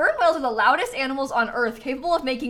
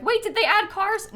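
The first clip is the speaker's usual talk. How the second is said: higher-pitched imitation of an excited viewer; mock excited question, pitched up; 10 semitones higher than usual. Imagined viewer seeing cars being placed, mock excited. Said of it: "getting really excited right now".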